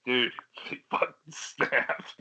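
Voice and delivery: ordinary talk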